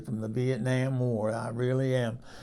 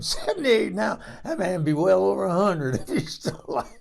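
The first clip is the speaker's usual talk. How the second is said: laughing through the words, higher and louder; 7 semitones higher, 5 dB louder than usual. Real, not acted. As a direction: chuckle at her own age as you say it. Laughs about her age.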